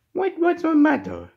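high, squeaky pretend question; high-voiced concern. Toy character asks what's wrong, high-voiced concern. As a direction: ask it far up in pitch. Asking upset character, first take.

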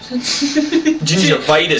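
Gingivitis stretched slowly in a high voice; high, drawn-out word. Pretend. Riffing on a teammate's ginger insult, high and drawn-out.

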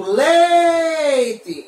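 LEITE called out loud with a long LEI; shouted word. Shown as a demonstration. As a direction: shout "leite", stretching the first syllable. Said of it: "eu grito leite". (grito alongando o LEI de "leite")

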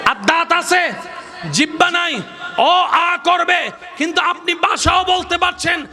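high-pitched, drawn-out sermon delivery; preaching at full force. Real, not acted. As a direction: proclaim it high to the whole crowd.

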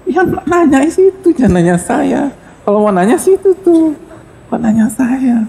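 a flustered scholar tells the mother to ask the other man; baffled protest. Acted. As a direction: point away in disbelief, why ask me. Scholar baffled at being asked.

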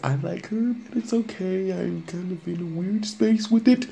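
nervous high confession voice; awkward high voice. Real, not acted. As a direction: squeak it out, embarrassed.

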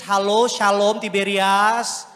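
very loud, drawn-out mock hail; calling out. Preacher chides those who remind God of verses, loud mocking call. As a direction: shout it out, stretching the last name.

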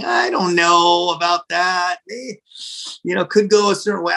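wary lawyer, mildly acted; hesitant doubt. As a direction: drag out the reservation, hedging. Attorney voices doubts about the name.

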